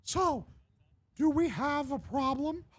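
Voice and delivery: high, slow, menacing mouse; Mickey Mouse. Acted. Mickey checks the band is cowed, slow high voice. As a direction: threaten sweetly in a cartoon falsetto. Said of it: "Legit South Park Mickey Mouse".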